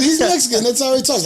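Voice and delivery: loud, very high retelling; imitated explaining. Repeats cashier's explanation louder.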